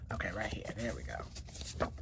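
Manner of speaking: hushed whisper to herself. Real, not acted. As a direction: breathe it out under your breath. Reader quietly drawing a card.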